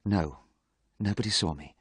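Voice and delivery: softly spoken, subdued reply; quietly. A narrator's reading. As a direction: answer under your breath. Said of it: "replied Dorian quietly".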